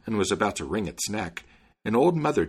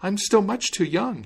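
put-on high young foal begging to be freed; a small frightened foal pleading; 11 semitones higher, 4 dB louder than usual. A narrator's reading. Foal pleads with the tailor, high and begging. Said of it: "the foal pleaded for his freedom".

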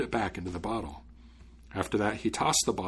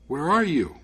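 raised, higher call into the unknown; 6 semitones higher, 5 dB louder than usual. A narrator's reading. Son calling to a hidden voice, calling out. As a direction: call out to an unseen voice. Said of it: "So he called out".

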